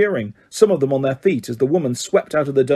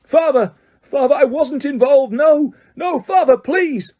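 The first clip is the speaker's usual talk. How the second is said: desperate plea; 11 semitones higher than usual. A narrator's reading. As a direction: scream for mercy. Boy dragged away pleading.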